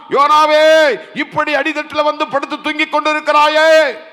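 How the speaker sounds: high, forceful rousing cry; urgent sailor. Acted. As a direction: shout the sleeper awake with stretched phrase endings. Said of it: "அந்த மாலுமி சொல்லுகிறான்". (உரக்க எழுப்பும் மாலுமி)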